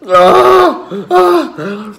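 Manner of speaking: real sudden scream of pain; pained cries. Real, not acted. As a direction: cry out as the burn flares.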